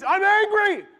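high, loud mock outburst of anger; mock angry outburst. Preacher mimics an angry churchgoer, loud mock outburst. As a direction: blurt it out like a hothead. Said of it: "It, something needs".

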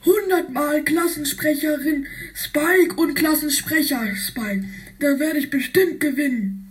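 high, quick put-on kid voice; sly character voice. Spike writing his name a hundred times, high sly voice. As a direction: rattle it off pleased with the trick.

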